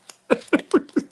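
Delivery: high, loud breathy laugh; burst of laughter. Real, not acted. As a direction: crack up at the surprise. Laugh at the minimum.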